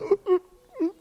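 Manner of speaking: high-pitched mock sobs; short, squeaky imitation sobs; imitated crying. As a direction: act out bursting into tears. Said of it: "começou a chorar".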